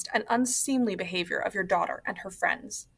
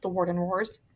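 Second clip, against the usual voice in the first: plain reading voice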